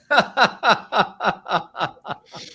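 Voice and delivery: short real laugh at the news; amused laugh. Real, not acted. Laughs at calls up sevenfold.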